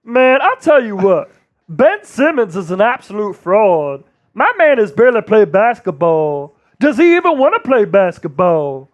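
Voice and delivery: from the depths of the vocal cords, blustery pundit; Charles Barkley voice. Retold or imitated. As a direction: rumble it out like a TV analyst ranting. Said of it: "that's my Charles Barkley impersonation".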